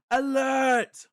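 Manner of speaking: high, very loud alarm cry; sentry's all-out warning shout; full-force shout. Acted. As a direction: yell the alarm at the top of your lungs. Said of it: "shouts with all his might".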